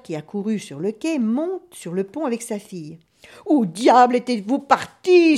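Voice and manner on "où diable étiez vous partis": loud, drawn-out yell of reproach; bawling. A narrator's reading. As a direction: holler at the returning crew. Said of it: "s'égosille-t-il".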